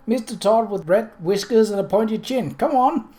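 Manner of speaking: put-on high, loud young boy voice; eager child correcting; excited boy. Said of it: "he said".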